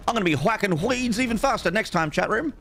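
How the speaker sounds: high, jokey boast; playful high voice. Real, not acted. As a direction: pitch up to tease chat.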